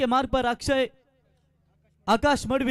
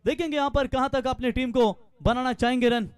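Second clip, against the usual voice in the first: ordinary delivery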